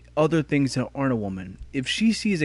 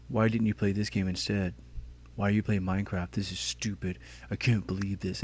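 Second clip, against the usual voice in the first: lower, softer, grumbling complaint; whiny hater; 6 semitones lower, 7 dB quieter than usual. Retold or imitated. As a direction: mumble it like a sulky viewer. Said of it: "Somebody's gonna say".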